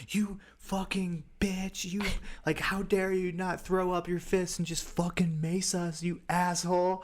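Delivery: high, strained impression of an outraged man; mock angry rant. Retold angry man hunting the sprayer.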